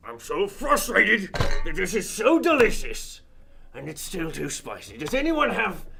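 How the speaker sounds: very loud, slow, anguished yell; frustrated shout. Acted. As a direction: bellow in spicy-food agony. Character burning from spicy muffin.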